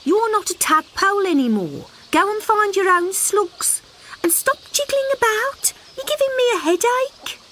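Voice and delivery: high mother voice, mildly scolding; scolding mother frog. Acted. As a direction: tell the child off lazily.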